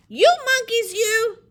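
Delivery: high, loud storybook scolding; hammed-up angry shout; scolding peddler. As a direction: holler it like a cross peddler. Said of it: "shaking his finger at them".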